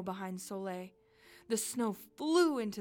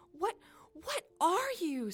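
higher and louder, taken aback; startled, stammering question; 10 semitones higher, 5 dB louder than usual. Acted. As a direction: stammer it in astonishment. Startled girl questions the creature.

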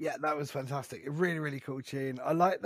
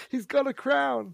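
laughing; 11 semitones higher, 9 dB louder, slower than usual. Pretend. Joking about crown fear.

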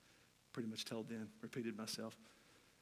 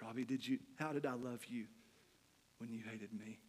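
softer, gentle asking, fading out; kind question; 2 semitones lower, 7 dB quieter than usual. Retold or imitated. The forgiving man gently asking Robbie, soft and tender. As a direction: let the question land tenderly. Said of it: "He said".

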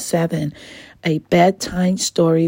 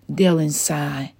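usual speaking voice

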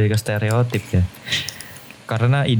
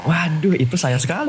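mock surprise; 8 semitones higher than usual. Mock shocked self-recognition.

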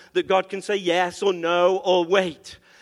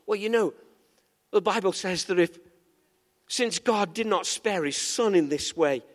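normal talking voice

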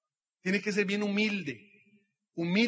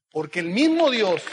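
booming preacher; 8 dB louder than usual. Real, not acted. Preaching climax to audience.

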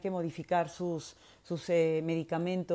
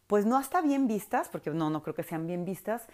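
usual speaking voice

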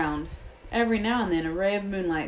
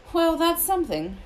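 higher, louder sighed remark; sighing; 11 semitones higher, 5 dB louder than usual. A narrator's reading. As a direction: say it with a touch of a sorrowful sigh. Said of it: "Ronan sighed".

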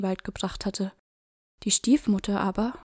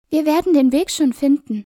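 put-on higher, louder brave little boy; boy's confident voice; 6 semitones higher, 9 dB louder than usual. A narrator's reading. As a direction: reassure his sister boldly. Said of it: "Hänsel sagte zu Gretel".

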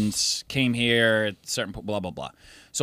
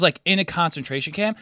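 ordinary talk

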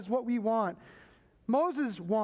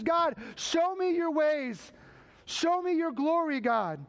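slow, higher earnest plea to God; Moses pleading; 7 semitones higher, slower than usual. Acted. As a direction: beg God slowly for his presence. Preacher voicing Moses praying.

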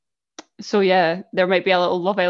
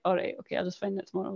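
indifferent; 11 dB quieter, faster than usual. Acted. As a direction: drop it off like you don't care. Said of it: "I don't really care".

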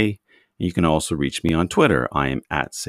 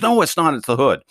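raised, louder playful protest; mock indignant correction; 9 semitones higher, 6 dB louder than usual. Teasing the British trainee, a mock loud correction. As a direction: pretend to be offended, insisting he is wrong.

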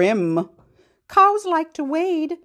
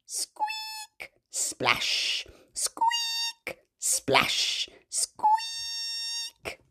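high whispered squeaks; squeaky mouse; 9 dB quieter, much slower than usual. Pretend. Teacher voices the mouse falling in water. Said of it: "Mouse says".

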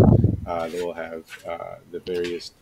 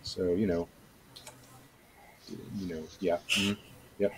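usual speaking voice

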